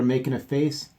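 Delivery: ordinary delivery, nothing marked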